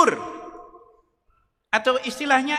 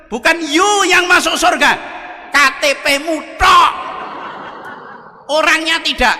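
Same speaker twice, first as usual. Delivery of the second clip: loud punchline; 7 semitones higher, 4 dB louder than usual. Acted. Jesus punchline at heaven's gate.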